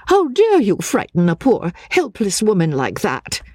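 high-pitched, protective outrage; indignant scolding. A narrator's reading. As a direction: scold him in a high woman's voice. Companion scolds the man.

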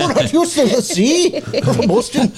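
put-on Irish accent. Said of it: "I'm from Boston, so I can do it".

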